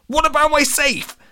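high, loud alarmed cry; panicked. A narrator's reading. Latham remembers his safe, panicking.

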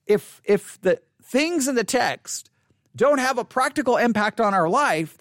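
usual speaking voice

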